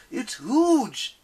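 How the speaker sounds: high, loud alarmed shout; yelling. Acted. Nico in the rigging, shocked high yell. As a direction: cry out in shock at the size. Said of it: "he yelled".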